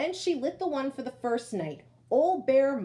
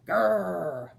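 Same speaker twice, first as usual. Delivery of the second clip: louder low growl from the bear; 3 semitones lower, 5 dB louder than usual. A narrator's reading. Old Bear growls at the smells, a low bear growl.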